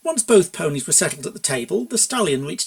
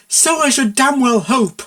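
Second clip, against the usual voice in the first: higher, louder, slow indignant retort; furious; 8 semitones higher, 7 dB louder than usual. Acted. Mayor scolding Buck, slow and furious. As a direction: bite out each word.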